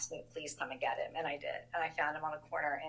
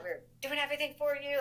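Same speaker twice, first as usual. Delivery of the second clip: much higher and louder, scolding her brother; retold yell; 9 semitones higher, 4 dB louder than usual. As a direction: yell it at him like a fed-up older sister. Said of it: "Like, I'm yelling at him".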